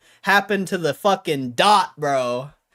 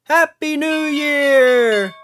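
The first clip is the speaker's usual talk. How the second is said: slow, high, loud cheer; celebratory shout; 11 semitones higher, 7 dB louder, much slower than usual. Pretend. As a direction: cheer it out like midnight. Mock New Year celebration.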